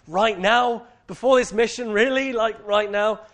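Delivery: high, drawn-out incredulous complaint; toddler tantrum. Retold or imitated. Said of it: "toddler mode started to rear its ugly head".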